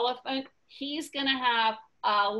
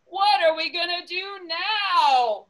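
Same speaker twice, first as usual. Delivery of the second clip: mock crying whine; hammed-up wail; 6 semitones higher, 4 dB louder than usual. Elephant wails.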